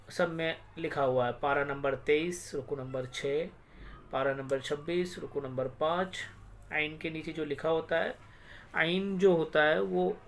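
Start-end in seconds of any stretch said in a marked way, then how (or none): none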